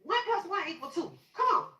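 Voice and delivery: high, desperate pleading; urgent high cry to the medics; retold screaming. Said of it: "I'm screaming to them".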